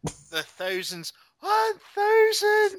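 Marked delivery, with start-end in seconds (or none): 1.4-2.8 high, slow, strained rock-singer wail; rock impression. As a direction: belt it like a hammy rock frontman. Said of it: "That was my rock impression".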